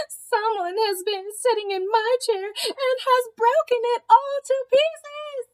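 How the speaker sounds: put-on very high, tiny Baby Bear crying out; wee little voice. A narrator's reading. Baby Bear finds his chair broken, very high wee voice. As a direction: squeak it like a tiny upset cub. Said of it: "cried in a wee little voice".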